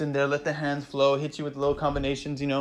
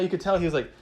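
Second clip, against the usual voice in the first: ordinary talk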